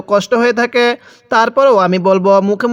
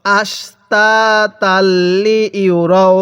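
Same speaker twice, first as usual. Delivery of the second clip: very slow, drawn-out syllables; model recitation; slower than usual. Shown as a demonstration. Teacher models Quran words for learners, slow measured recitation. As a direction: stretch each long vowel evenly. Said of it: "কিভাবে পড়ব".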